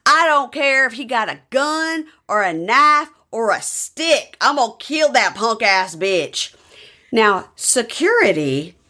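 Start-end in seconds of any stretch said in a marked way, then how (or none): 0.1-6.5 high, hammed-up threatening rant; mock tough-guy tirade, pitched up; Jeff. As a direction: act out a riled man's threat, slowing into the final insult. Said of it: "And, uh, Jeff says".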